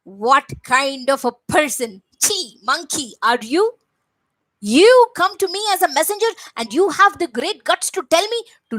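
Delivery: raging king shouting down a messenger; roaring in anger. Acted. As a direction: roar it at the monkey like a demon king. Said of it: "He roared in anger".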